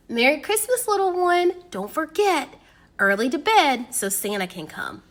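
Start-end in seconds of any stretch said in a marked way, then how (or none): none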